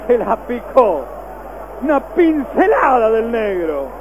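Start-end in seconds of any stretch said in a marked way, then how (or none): none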